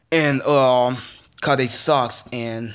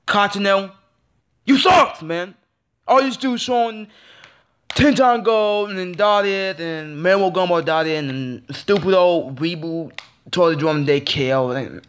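furious high yell; 4 semitones higher than usual. Real, not acted. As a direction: shout your fury at the channel. Ranting at the TV network.

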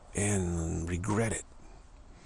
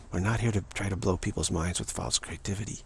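usual speaking voice

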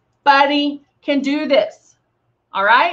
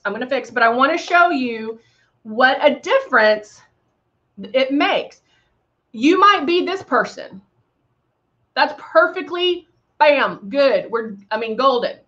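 ordinary delivery

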